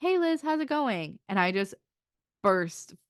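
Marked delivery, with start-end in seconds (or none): 0.0-1.2 cheerful greeting. Retold or imitated. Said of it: "And she's like".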